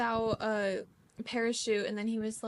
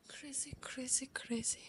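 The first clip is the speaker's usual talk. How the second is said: whispered crazy; 3 semitones higher, 12 dB quieter than usual. Real, not acted. Friends riffing on "crazy.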